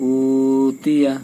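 উতিয়া with the opening উ drawn out slowly; madd lengthening. Shown as a demonstration. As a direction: hold the উ of উতিয়া long. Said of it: "আর এটার ভিতরে প্রথমটায় টান". (উতিয়া প্রথম উ টেনে)

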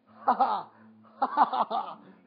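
big, high burst of laughter; loud laugh. Real, not acted. Watching The Bachelor and bursting into loud laughter. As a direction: crack up loudly at the screen. Cracking up at the show.

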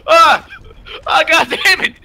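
frustrated yell. Real, not acted. Dying in game, frustrated.

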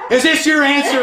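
high, loud pleading cry; high-pitched retold plea at a locked church door; desperate plea to God. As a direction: retell begging heaven for a sign. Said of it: "I'm audibly doing this".

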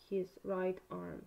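ordinary delivery

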